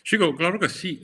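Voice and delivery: loud, high burst of agreement; emphatic. Real, not acted. To the interviewer asking about fear: emphatic. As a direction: blurt it out like it's obvious. Admits fear of risking career.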